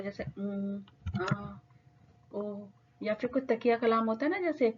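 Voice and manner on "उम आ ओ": drawn-out, hesitant "um, aa, o"; filler sounds. Shown as a demonstration. Teacher showing students fillers to avoid, drawn-out filler sounds. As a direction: hold each filler sound slowly, with gaps. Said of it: "कोई भी एक ऐसा filler use ना करें".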